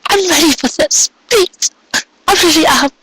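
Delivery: very high, desperate begging mare; begging aloud. A narrator's reading. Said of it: "she begged aloud".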